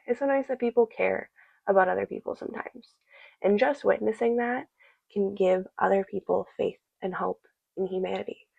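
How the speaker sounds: ordinary talk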